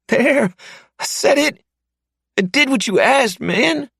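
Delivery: high, panicked pleading voice, fast and loud; terrified captive man. Acted. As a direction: beg for your life in a frantic high voice. Mark after confessing.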